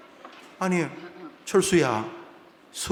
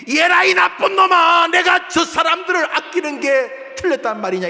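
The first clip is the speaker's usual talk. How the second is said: high, loud furious shout; God's roar; 12 semitones higher, 8 dB louder than usual. Acted. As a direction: bellow the rebuke at Jonah. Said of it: "저건 하나님의 고함입니다". (요나를 꾸짖는 하나님의 높고 큰 고함)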